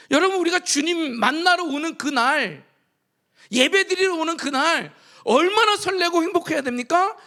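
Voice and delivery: fervent preaching. Acted. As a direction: stir the church up with conviction. Preaching at full force. (열정적으로 외치는 설교)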